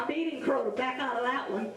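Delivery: plain reading voice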